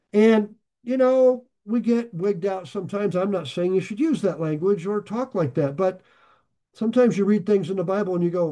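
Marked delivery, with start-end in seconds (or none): none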